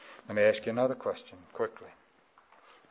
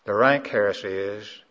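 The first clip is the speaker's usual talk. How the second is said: ordinary talk, nothing marked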